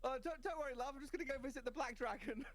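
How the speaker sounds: chirpy British reassurance; British accent. Retold or imitated. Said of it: "Are all the middle creeps British?".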